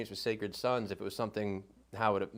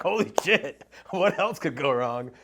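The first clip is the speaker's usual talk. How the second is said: much higher and louder, laughing through it; laughing exasperation; 5 semitones higher, 9 dB louder than usual. Real, not acted. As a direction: laugh at your own bad luck.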